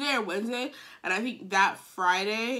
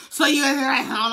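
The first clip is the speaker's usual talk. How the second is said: mock angry yell, loud and raised; blowing up; 4 semitones higher, 8 dB louder than usual. Boyfriend confronting her about his phone, blowing up. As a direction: imitate him blowing up over the phone. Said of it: "he's blowing up at me".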